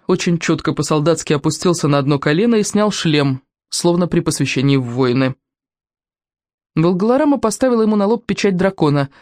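ordinary delivery

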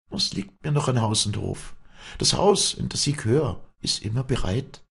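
high dialect voice; Walli, bitterly resigned. Acted. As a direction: brush off the loss of your home. Walli dismisses loss of house.

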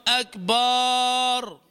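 "أكبر" as long sustained "akbaaar"; lengthened A. Shown as a demonstration. Teacher naming the commonest mistake, drawn-out final A. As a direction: hold the last vowel of أكبر in a level chant. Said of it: "من أكثر الأخطاء انتشارا".

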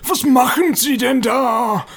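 grumpy caretaker telling someone off; bad-tempered. Caretaker scolds visitors dripping on his freshly waxed floor, bad-tempered. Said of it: "er scheint schlechte Laune zu haben".

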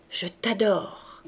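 slow, drawn-out tender words; adoring. A narrator's reading. Cosette answering her husband's declaration, adoring. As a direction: linger lovingly over it.

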